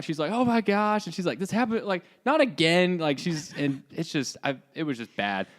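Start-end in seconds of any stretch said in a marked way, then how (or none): 0.3-1.0 high-pitched mock dismay; imitated freaking out. Girlfriend watching the fight from the car, imitated freak-out. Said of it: "like, freaking out". 1.4-3.0 higher, mildly panicked quote; a touch of mock dismay, pitched up; imitated freaking out. Girlfriend seeing it happen again, lightly imitated freak-out. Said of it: "like, freaking out".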